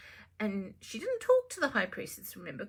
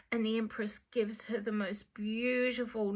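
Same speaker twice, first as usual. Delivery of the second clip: ordinary delivery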